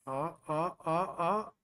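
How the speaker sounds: ordinary delivery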